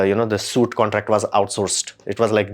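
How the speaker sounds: normal talking voice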